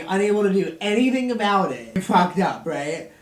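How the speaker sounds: ordinary talk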